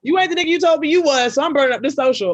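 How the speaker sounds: loud, high, worked-up outburst; loud high rant at the ex who lied; 1 heated outburst. Retold or imitated. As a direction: blast it out in a fury. Burning his social, ranting.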